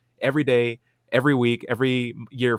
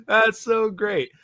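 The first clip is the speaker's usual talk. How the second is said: laughing hard, then slowly; 8 semitones higher, 7 dB louder, much slower than usual. Real, not acted. Cohost laughing at the Barbie house clip. Reacting to Bible bat clip.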